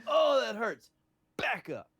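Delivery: high, loud play-acted yelp; mock pained shout. Co-host acts out being stabbed, hammed-up shout. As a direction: cry out in exaggerated, joking pain.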